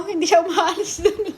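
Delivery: amused at the cameraman; talking through laughter; laughing. Real, not acted.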